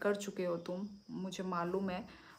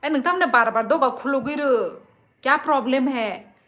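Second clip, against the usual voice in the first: loud, high scolding yell; furious high-pitched telling-off; angry scolding; 5 semitones higher, 14 dB louder than usual. Pretend. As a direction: shout at someone to shut the door. Acted angry scolding at door.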